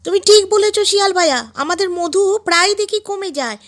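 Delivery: high-pitched little bee voice; high, eager insect character voice; agreeing bees. Acted.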